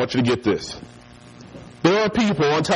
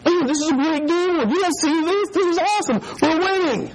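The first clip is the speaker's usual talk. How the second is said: high-pitched giddy fan; mock excitement; 13 semitones higher than usual. Boy insisting the blank TV shows a game, mock excitement. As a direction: rave like an excited kid.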